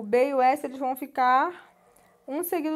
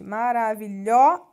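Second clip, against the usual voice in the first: maravilhosa with lhó punched and held; stress on lhó; 3 semitones lower, 4 dB louder than usual. Shown as a demonstration. Teacher to students, marking the stressed syllable lhó in maravilhosa. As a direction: lean hard on lhó in maravilhosa. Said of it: "o lhó é o mais forte".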